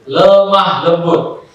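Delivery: drawn-out "lemah lembut", each syllable stretched; slow, drawn out. Shown as a demonstration. Preacher reading his dorm note, "lemah lembut" drawn out slowly. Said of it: "saya tulis itu".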